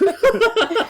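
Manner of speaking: squealing high laugh; high burst of laughter. Real, not acted. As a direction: crack up suddenly. Laughing at the joke.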